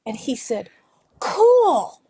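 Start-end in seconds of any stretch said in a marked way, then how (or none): 1.2-2.0 high, loud awestruck exclamation; impressed boy. Retold or imitated. Boy answering the coach about the voice, amazed. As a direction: imitate a boy who's just been amazed. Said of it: "he just thought it was so cool".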